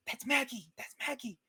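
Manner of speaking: high, hushed squeal of excitement; excited friend-spotting. Acted. Dermot spots his friend.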